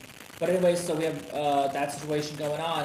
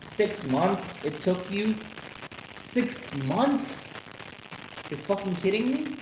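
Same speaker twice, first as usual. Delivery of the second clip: raised-pitch questions of disbelief; incredulous; 5 semitones higher, slower than usual. Real, not acted. Vlogger mocking the slow revenge angle, incredulous high questions. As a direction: ask it as if it can't be true. Disbelief at the six-month wait.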